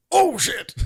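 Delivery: loud, high-pitched exclamation; hammed-up monster outburst, shouted high; Venom. Host retelling a favorite film scene, hammed-up Venom yelp. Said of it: "and then Venom goes".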